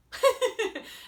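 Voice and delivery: laugh. Real, not acted. Laughing at herself.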